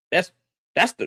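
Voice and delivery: ordinary talk, nothing marked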